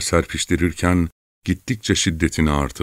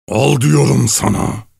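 high, loud, drawn-out roar; terrible howl; 7 semitones higher, 5 dB louder than usual. A narrator's reading. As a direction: howl it slowly in fury. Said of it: "Korkunç bir sesle".

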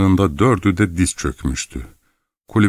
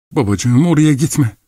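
high, raised plea; alarmed pleading; 7 semitones higher, 3 dB louder than usual. A narrator's reading. Daughter begs father not to go, alarmed. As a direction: implore him, rising in pitch.

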